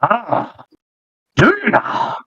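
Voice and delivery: high, thin crone voice; old witch voice. Acted.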